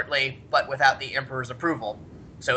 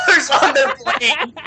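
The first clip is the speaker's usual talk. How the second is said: loud, higher, laughing through the words; 10 semitones higher, 6 dB louder than usual. Real, not acted. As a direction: burst into laughter mid-phrase. Cracking up at his own story.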